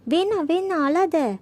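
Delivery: put-on pleading child. A narrator's reading.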